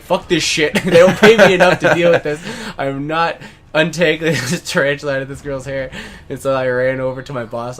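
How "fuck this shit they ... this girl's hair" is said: loud, laughing refusal; running from the tarantula, loud laughing rant; laughing mock rant. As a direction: blurt it out while laughing. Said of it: "I was like".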